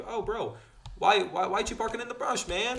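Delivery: high exasperated mock instructor; instructor's exasperation. Instructor scolding the student in a high mock whine. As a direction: whine it like the annoyed examiner. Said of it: "And he's like".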